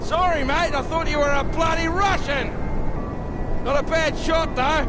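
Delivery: very high, loud cheery holler; loud, careless call from out in the cold; calling out from afar. Acted. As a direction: shout it across a long distance. Calling from far off outside.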